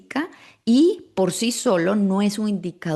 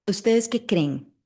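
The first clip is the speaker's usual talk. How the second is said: ordinary delivery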